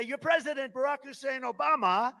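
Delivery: high, loud crowd call; rally announcement. Retold or imitated. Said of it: "So I'll say".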